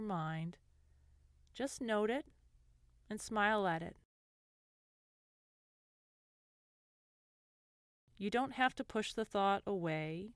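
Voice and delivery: gentle guided meditation voice, lingering between phrases. Acted. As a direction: ease the listener into calm, unhurried.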